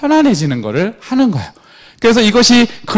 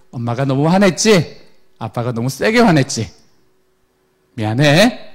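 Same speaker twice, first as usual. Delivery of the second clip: gentle apology; 6 semitones lower than usual. Retold or imitated. Parent apologizing to child.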